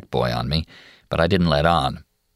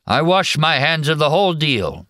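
put-on high, thin old man's resigned voice; a tired old guy giving up on it all; 9 semitones higher than usual. Old patient's weary dismissal.

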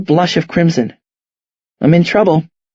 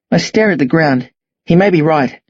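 ordinary delivery

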